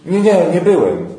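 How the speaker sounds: high, loud, panicky no; frightened denial. Acted. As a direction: deny it in a scared rush. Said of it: "zalękniony krzyczy". (przestraszony Piotr krzyczy, zaprzeczając)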